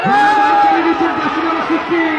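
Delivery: sudden high, loud commentary cry; excited commentary. Real, not acted. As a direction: leap up in pitch on the pass. Commentator's voice leaps up mid-call.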